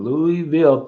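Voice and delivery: Louisville said fully as "LOO-ee-vill"; out-of-towner's "Loo-ee-vill" for Louisville; out-of-town pronunciation. Shown as a demonstration. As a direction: spell out every syllable of Louisville, "Loo-ee-vill". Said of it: "people from out of town".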